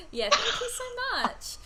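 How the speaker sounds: high, laughing as you thank her; thanks through a giggle; laughing gratitude. Real, not acted.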